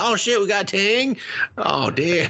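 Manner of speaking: high, gleeful mock excitement; hammed-up delight at finding Tang. Friend mocking the squire finding Tang, high and gleeful.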